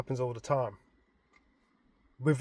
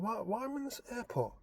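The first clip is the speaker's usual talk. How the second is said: puzzled; 7 semitones higher, 11 dB quieter than usual. Retold or imitated. Confused in dream.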